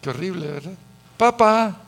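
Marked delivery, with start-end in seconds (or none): 1.2-1.8 loud, higher call of Papá, Papá; mock child's loud call for dad. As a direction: call for dad across the house like an impatient kid. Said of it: "hasta así le dicen".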